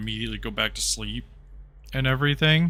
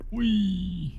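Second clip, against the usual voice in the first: playful whee; 7 semitones higher than usual. Real, not acted. Sliding down stairwell playfully.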